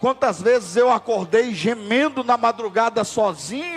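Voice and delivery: loud, high, slow impassioned preaching; preaching at full force. Acted. Preacher crying out to the congregation, loud and slow. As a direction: pour it out, building to a cry. Sermon climbing to climax.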